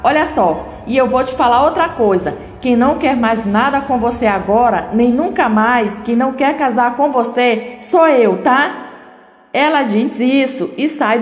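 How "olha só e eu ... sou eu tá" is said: ordinary delivery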